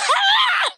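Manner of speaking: high shrieking laugh. Real, not acted. Laughing off the claim she is crying, high squealing laugh. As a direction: burst out in a shrill cackle.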